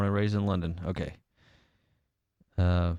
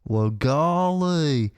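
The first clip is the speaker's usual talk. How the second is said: put-on Southern accent; 9 semitones higher, 4 dB louder, slower than usual. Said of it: "they just do a Southern accent".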